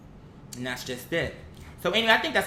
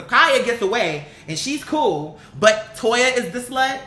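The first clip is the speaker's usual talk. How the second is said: slow, louder and higher outraged question; indignant disbelief; 5 semitones higher, 5 dB louder, slower than usual. Real, not acted. Vlogger calling out fans' double standard, indignant and slow. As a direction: lay out the hypocrisy with disbelief.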